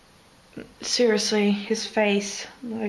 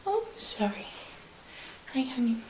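cooing to a pet; 3 semitones higher, 10 dB quieter, faster than usual. Real, not acted. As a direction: coo sweetly to the bird. Talking to the pet bird.

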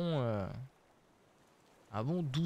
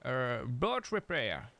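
"boat repair" read slowly in a clumsy French-flavoured English accent; bad English accent; slower than usual. Real, not acted. French gamer reading an English game label, slow clumsy English accent. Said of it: "J'ai un accent anglais horrible".

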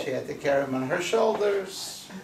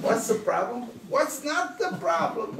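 rising, much higher outraged comeback; indignant retort; 9 semitones higher than usual. Acted. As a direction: throw the question back, incredulous. Hagar retorts to the angel.

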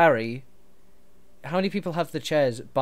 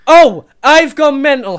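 louder, higher, slow indignant scoff; sarcastic outburst; 10 semitones higher, 17 dB louder, slower than usual. Acted. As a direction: throw the accusation back. Sarcastic retort in argument.

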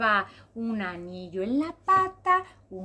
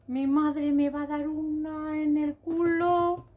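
hammed-up tearful wail, drawn out and higher; płaczliwe zawodzenie; 6 semitones higher, 4 dB louder than usual. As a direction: wail like an upset little zebra. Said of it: "Se puso a llorar".

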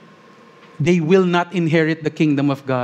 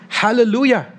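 high ringing hallelujah, called out to heaven; a reader lifting a hallelujah from Revelation; crying out; 8 semitones higher, 4 dB louder than usual. A narrator's reading. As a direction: cry it up high like a crowd praising. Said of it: "they cried out".

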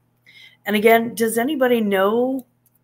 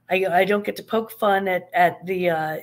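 ordinary delivery

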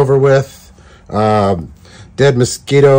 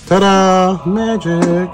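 showman reveal; 8 semitones higher, much slower than usual. Acted.

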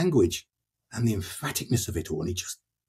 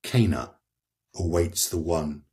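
poetry reading; 3 semitones lower than usual. Acted. Reading what Francesca says of her killer, poetry reading. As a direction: start raised, then sink into understatement with long pauses. Reads Francesca's lines aloud.

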